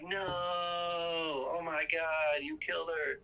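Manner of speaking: high, long drawn-out "no"; mock anguished wail. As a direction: imitate a horrified mother's wail. Mom learns daughter was killed, retold.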